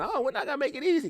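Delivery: high, quick mock protest; high-pitched imagined whine of refusal; mock objection. Voicing the judge's imagined objection.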